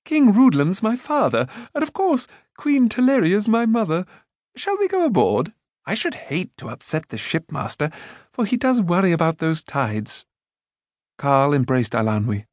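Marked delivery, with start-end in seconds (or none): 0.0-10.2 much higher, cheerful rambling voice; scatterbrained Prince Rune. A narrator's reading. Rune to the companions, proud and breezy. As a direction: chatter on brightly.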